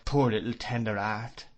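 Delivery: ordinary delivery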